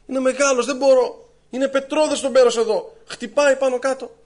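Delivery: high-pitched protest to an angel; acted crying complaint. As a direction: plead your case, put-upon and high. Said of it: "τι φωνάζεις".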